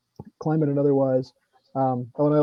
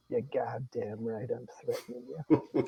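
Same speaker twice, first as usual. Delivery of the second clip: put-on slow, much softer, thick Boston accent; 2 semitones lower, 11 dB quieter, slower than usual. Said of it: "in his thick Boston accent goes".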